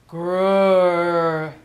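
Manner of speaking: a long, loud bear growl. Acted. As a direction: rumble it out like a bear.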